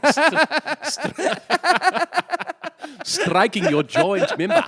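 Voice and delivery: put-on laughing through a South African accent; cracking up mid South African line. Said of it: "that accent is never gonna be sexy".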